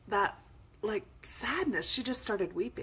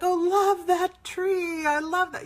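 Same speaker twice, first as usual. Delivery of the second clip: gushing; 11 semitones higher, 10 dB louder than usual. Retold or imitated. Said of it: "I'm like".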